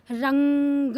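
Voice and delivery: रंग with a long held nasal, ग released after; drawn-out anusvara. Shown as a demonstration. Teacher sounding out a word, drawn-out anusvara. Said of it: "इस तरीके के से इसका उच्चारण".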